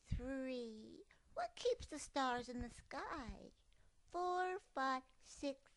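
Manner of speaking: high playful cub voice; little cub counting. Acted. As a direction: count like a tumbling child. Cub counts while cartwheeling.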